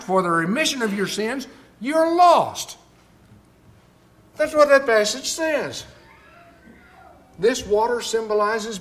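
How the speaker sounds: loud, high, driving sermon delivery; fiery pulpit preaching, building up; full-force preaching. Acted. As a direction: preach it hard to the whole room.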